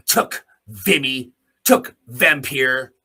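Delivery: "took Vimy" and "took Vampire" slow and loud, hammered word by word; slow proud punch. Real, not acted. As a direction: drive each battle name home slowly.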